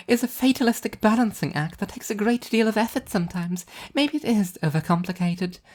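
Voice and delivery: high, bright cheerful angel voice; an upbeat angel showing off her plan; high, cheerful divine woman; cheerful angel Arbitrage. A narrator's reading.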